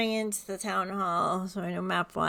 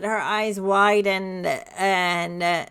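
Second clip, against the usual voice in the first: normal talking voice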